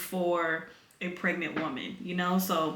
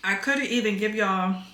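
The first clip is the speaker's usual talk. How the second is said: ordinary delivery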